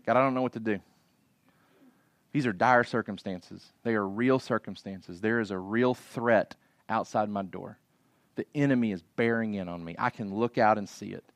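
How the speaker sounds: low, hushed plea to God; humble prayer. Acted. King praying to God amid a real threat, humble and subdued. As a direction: confess it softly, in humility. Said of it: "he's crying out in humility to God".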